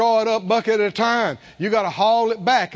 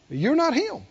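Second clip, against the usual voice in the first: ordinary talk, nothing marked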